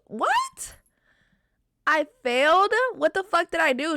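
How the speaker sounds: high, stunned yelp of disbelief; shocked disbelief. Retold or imitated. To the examiner who just failed her, a stunned high "What?". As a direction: squeak out your total shock. Told she failed the test.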